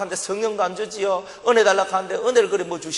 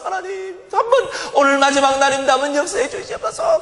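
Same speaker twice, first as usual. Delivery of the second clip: raised, pleading cry to God; pleading prayer; 7 semitones higher than usual. Retold or imitated. A believer pleading with God in prayer, raised pleading voice. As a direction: beg God for one last chance.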